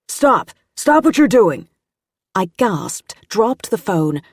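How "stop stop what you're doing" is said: loud, high-pitched command to stop; shouted order. A narrator's reading. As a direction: shout to halt an intruder. Agent catches intruder at desk.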